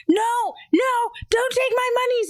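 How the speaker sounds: very high mock tantrum; hammed-up kid wail of protest; imitated screaming child. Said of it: "he's, like, crying and screaming".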